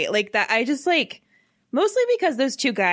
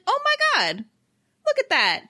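high, astonished "oh my God"; surprised gasp. Retold or imitated. Friend retelling her shock at the movie reveal, high and surprised. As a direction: squeal it out like seeing the big reveal. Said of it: "I was like".

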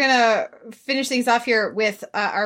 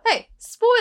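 high, loud mock-British attempt; failed British accent; 13 semitones higher, 5 dB louder than usual. Shown as a demonstration. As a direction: try British and give up, half laughing. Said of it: "I can't do a British accent".

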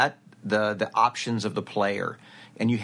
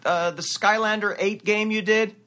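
high, louder eager fan voice; a fan asking, much higher; 11 semitones higher, 4 dB louder than usual. Retold or imitated. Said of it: "people say".

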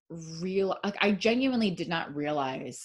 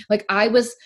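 ordinary delivery

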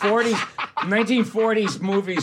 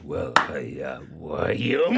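lower, slow, grumbling; slow grumble of a calmed bar owner; imitating Red; 10 semitones lower, 4 dB quieter, slower than usual. Red turns reasonable with the caller, lower and slow. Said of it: "he was like".

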